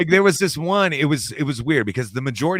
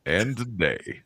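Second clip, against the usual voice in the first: lightly put-on announcer intro; mock narrator voice; 4 dB quieter, slower than usual. Host copying the guest's narrator intro, lightly mock announcer voice. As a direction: echo the narrator intro with a touch of show. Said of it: "it's just that voice".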